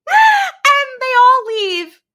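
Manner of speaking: high, loud laughing; real laughter. Real, not acted. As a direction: crack up while speaking. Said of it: "laughing fit".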